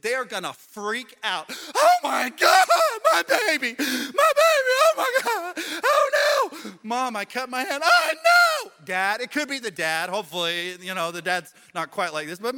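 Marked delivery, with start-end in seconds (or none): 1.7-8.7 shrieking, much higher and louder hysterical parent; panicking parent. Retold or imitated. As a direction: lose it completely over a cut hand. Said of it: "that one parent is going to absolutely lose it".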